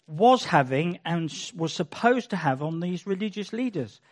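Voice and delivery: ordinary delivery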